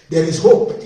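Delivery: preacher calling out "There is hope" loudly; loud proclamation. Real, not acted. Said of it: "repeated refrain, louder take".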